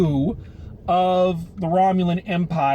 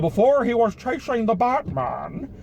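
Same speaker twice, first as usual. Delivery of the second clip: higher-pitched, put-on movie villain voice; mock masked-villain voice, pitched up; Bane voice; 5 semitones higher than usual. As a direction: slip into a playful supervillain impression. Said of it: "do that in the Bane voice".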